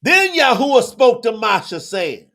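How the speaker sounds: loud, high preacher's reading; booming opening of a scripture reading; scripture proclamation. Real, not acted. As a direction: proclaim it at full force, easing at the end. Begins reading scripture aloud.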